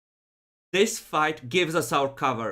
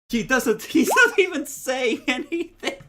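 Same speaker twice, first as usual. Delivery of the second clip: laughing; 11 semitones higher, 5 dB louder than usual. Real, not acted. Laughing at the silent player.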